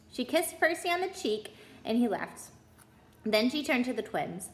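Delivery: usual speaking voice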